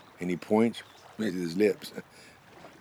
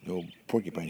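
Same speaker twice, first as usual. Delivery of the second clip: ordinary delivery, nothing marked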